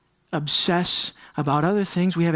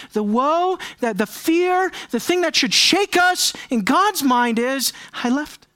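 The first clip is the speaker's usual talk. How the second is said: rising emphasis; 6 semitones higher than usual. Real, not acted. Preaching climax.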